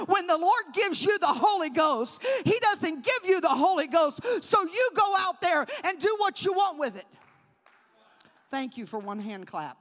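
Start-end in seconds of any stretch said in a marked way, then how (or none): 0.1-7.1 loud, driving sermon; loud preacher declaring; full-force preaching. Acted.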